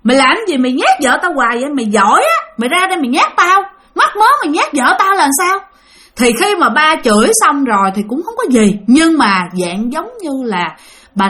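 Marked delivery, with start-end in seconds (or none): 0.0-5.6 imitating dad's indignant rant, climbing much higher; retold angry scolding. Dad dares the ghost who keeps scaring his wife, mounting angry scolding. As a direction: build from grumbling challenge to high, heated demand. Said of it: "cái ba mới chửi".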